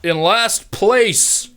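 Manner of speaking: slow, grand announcer reading the results. Acted. Game master announcing last place to the crowd, grandly. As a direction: call the names out like a ringmaster.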